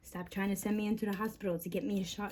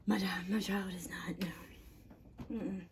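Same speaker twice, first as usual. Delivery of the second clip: whispered; 4 dB quieter than usual. Real, not acted. Whispering about her child.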